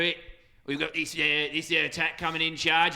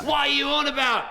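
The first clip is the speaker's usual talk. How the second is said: mock-indignant Aussie retort, higher; Aussie accent; 6 semitones higher, 4 dB louder than usual. Scolding co-caster in a mock Aussie accent. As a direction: snap back like a cartoon Aussie. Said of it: "shitty Aussie accents".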